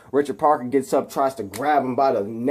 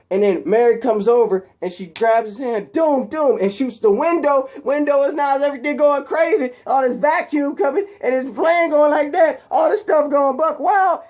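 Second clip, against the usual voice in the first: high, excited play-by-play building to a squeal; frantic action retelling; 10 semitones higher, 3 dB louder than usual. Retold or imitated. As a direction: get carried away, pitch climbing with the chaos. Acting out a frantic plane fight.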